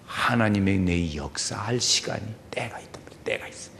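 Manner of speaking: hushed unvoiced emphasis; whisper. Real, not acted. Preacher whispering to the congregation about God's timing. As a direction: drop to a whisper to draw them in.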